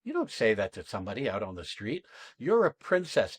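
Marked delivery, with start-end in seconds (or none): 2.4-3.4 higher-pitched, wholehearted statement; really meaning it. Shown as a demonstration. Coach showing what one never says on the street, meant. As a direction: say it as if you truly mean it. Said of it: "and really mean it".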